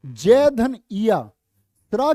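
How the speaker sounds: जय and इया drawn out, slowly split apart; slow syllable breakdown. Shown as a demonstration. Teacher breaking Jiya into sounds for the class, slow. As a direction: spell out Jiya slowly, part by part.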